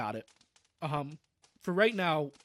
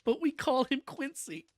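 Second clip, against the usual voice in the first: steady storybook reading voice; story narration; 3 semitones higher than usual. A narrator's reading. Streamer reading the book's ending aloud, storybook narration. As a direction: read the closing pages to the chat. Reads the novel's closing narration.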